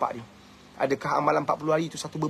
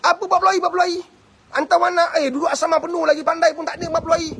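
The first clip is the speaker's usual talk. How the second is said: high, loud mocking parent's rant; shrill mock outrage, fast at the end; scoffing parent; 11 semitones higher, 4 dB louder, faster than usual. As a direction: ridicule the forty days as a scoffing parent. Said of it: "dia tangkap".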